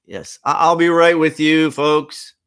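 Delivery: calm the crowd while rushing; busy doctor. Acted. Doctor answers waiting patients.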